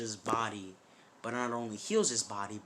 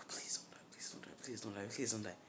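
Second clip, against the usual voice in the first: hushed, urgent begging; rapid breath-only pleading; whispered plea; 8 dB quieter, faster than usual. Acted. Pleading over someone in agony.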